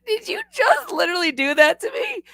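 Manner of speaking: high, squeaky outraged disbelief; laughing mock outrage. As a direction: sound stunned he just hung up. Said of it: "you're like".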